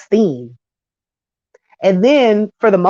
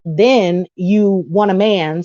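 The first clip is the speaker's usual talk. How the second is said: ordinary delivery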